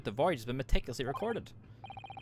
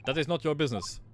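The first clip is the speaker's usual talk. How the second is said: put-on blunt Russian-accented refusal, higher pitched; curt Russian accent again; 3 semitones higher than usual. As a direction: shut them down in a Russian accent.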